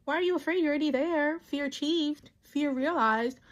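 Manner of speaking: high, loud mock incredulity; hammed-up, high-pitched taunting; mocking commenters. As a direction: imitate a crowd piling on with blunt mockery. Acts out piling-on mocking comments.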